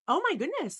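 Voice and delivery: mildly awed, pitch lifted; amazed realization. Retold or imitated. Retelling her amazed reaction.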